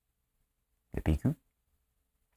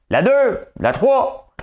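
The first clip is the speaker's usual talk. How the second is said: mock camera calls; 12 semitones higher, 6 dB louder, much slower than usual. Mock calling camera numbers.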